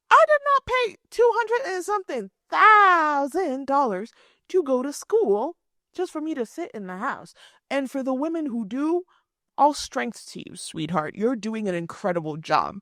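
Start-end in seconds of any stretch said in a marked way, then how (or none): 0.1-7.3 indignant retort. Retold or imitated. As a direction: let the outrage burst out. Indignant reply to date.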